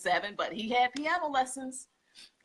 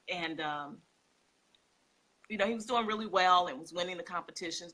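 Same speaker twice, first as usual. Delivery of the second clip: ordinary delivery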